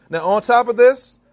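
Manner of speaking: usual speaking voice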